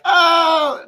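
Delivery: loud, high drawn-out oh; hammed-up hesitant groan; editor balking. Imitates editors balking at the pitch.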